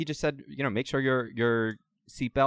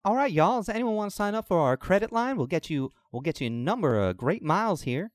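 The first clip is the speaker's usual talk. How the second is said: flight attendant; 8 semitones higher than usual. Acted. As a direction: pitch the credit card like cabin crew. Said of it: "and then she's".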